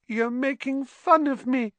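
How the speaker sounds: high, wounded whine; hurt, near-crying squeal; tearful. Acted. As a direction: accuse them as tears well up. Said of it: "silver tears welling rapidly".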